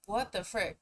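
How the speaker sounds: ordinary talk, nothing marked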